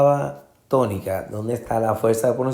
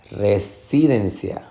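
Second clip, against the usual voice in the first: residencia with SI raised; misplaced second stress. Shown as a demonstration. Teacher testing options, re-SI-dencia. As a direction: lean on SI in residencia. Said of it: "tratar de identificar".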